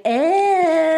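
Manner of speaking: high, drawn-out mock "ehh"; imitated "eh". Retelling the trench coat reveal, high mock "ehh". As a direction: imitate her with a high, stretched squeal. Acts out Lisa's flashing reveal.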